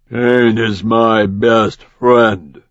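slow, measured grim boast; Blade, grim fighter. Acted. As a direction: savor each word like a threat. Blade voiced by narrator.

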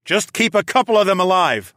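commanding shout over battle; ordering. A narrator's reading. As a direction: bark orders to your fighters. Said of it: "I ordered".

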